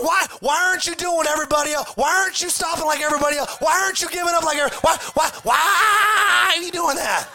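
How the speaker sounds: fast, high shouting, slowing into a long final shout; frantic yelling. Retold or imitated. Boat crowd shouting at Peter in the water. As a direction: build up yelling at him. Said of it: "Everybody's in the boat going".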